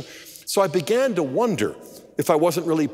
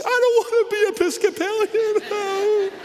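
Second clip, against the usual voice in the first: very high, hammed-up crying voice; mock tearful wail. Wife bursts into tears at husband's church idea, mock wailing. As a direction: imitate a wife bawling in protest. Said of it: "seen her burst into tears".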